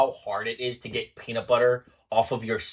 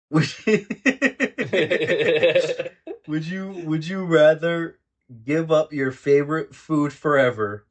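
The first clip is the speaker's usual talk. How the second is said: laughing; 4 semitones higher than usual. Real, not acted. Laughs while reading card question.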